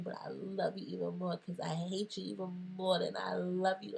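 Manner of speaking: ordinary talk, nothing marked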